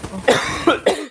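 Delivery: tosse curta e seca. Real, not acted. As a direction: uma tossida rápida e seca. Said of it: "desculpa a tosse aí". (tosse seca de verdade)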